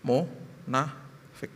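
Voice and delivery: Munafik, said slowly and softly with contempt; slow condemnation. Acted. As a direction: drag out Munafik with quiet scorn. Sermon climax single word.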